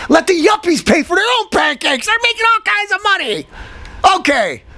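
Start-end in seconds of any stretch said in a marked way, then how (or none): 0.1-3.5 shouting. Real, not acted. Ranting at yuppie spending.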